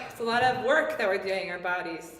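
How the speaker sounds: normal talking voice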